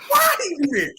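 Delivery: high yelled question at the coach; shouted taunt. Retold or imitated. Rival coach yelling at him across the field, high shout.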